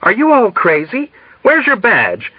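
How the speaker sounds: alarmed colonel losing his nerve. A narrator's reading. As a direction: cry out in sudden alarm. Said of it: "blanched with alarm".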